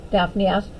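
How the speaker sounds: ordinary delivery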